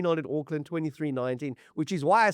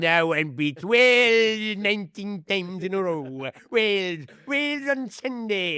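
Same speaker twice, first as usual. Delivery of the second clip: slow, higher sing-song Welsh lilt; mock Welsh accent; 5 semitones higher, 3 dB louder, slower than usual. Host mocking Welsh fans' pride in a Welsh accent. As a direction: ham up a proud Welsh fan.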